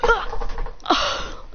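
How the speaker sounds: high yelp, then voiceless gasp; shocked cry. Acted. Classmates feeling a shock in their legs, crying out.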